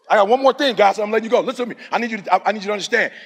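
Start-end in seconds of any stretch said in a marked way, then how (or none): none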